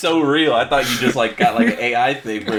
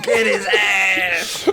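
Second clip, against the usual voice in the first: high, drawn-out egging-on; hyped cheer; 8 semitones higher, much slower than usual. Pretend. Reacting to a friend's comeback story, a high hyped cheer. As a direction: cheer him on, stretched out high.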